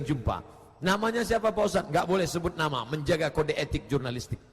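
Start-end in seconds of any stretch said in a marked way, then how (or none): none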